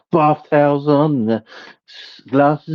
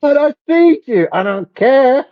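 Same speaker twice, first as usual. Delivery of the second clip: squeaky ant voice; 13 semitones higher than usual. Pretend. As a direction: squeak like an angry tiny ant.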